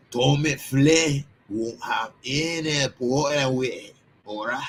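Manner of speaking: slow, put-on Irish brogue; Irish accent. Shown as a demonstration. Streamer showing off to viewers, playful Irish accent. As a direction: say it in a little mock Irish accent. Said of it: "That's my little Irish accent".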